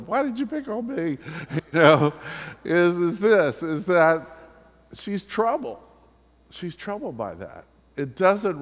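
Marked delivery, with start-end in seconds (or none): 0.1-4.3 much higher, playful whiny voice; mock protest. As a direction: play the embarrassed guy in jest. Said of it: "as I'm sure Joe's going".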